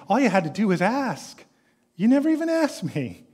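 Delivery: high, exasperated voice; rebuking father. Acted. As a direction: make him see it was his for the asking.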